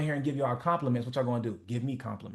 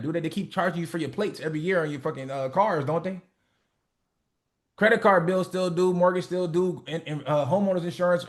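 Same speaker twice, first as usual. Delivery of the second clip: ordinary talk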